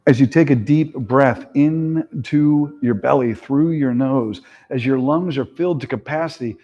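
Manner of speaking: steady, calm breathing cues; guided meditation. Acted. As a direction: lead the breath gently.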